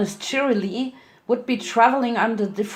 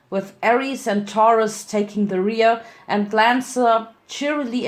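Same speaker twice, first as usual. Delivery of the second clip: ordinary delivery, nothing marked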